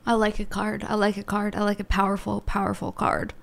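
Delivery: low intense murmur; intense hush. Real, not acted. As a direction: say it low and obsessive. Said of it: "looking at me like I'm mentally ill".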